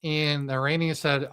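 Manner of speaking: ordinary talk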